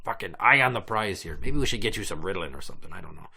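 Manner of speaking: ordinary delivery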